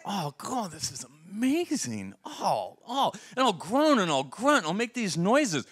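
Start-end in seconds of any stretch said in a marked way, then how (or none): 0.1-3.1 quiet food-pleasure moan. Retold or imitated. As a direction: moan over a great bite. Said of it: "I'll go".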